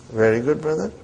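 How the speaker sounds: ordinary talk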